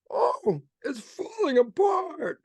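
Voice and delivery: very high, drawn-out panicked cry; hammed-up high-pitched dismay; mock fearful wail. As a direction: wail as if the world is crumbling. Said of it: "to them, they have fear".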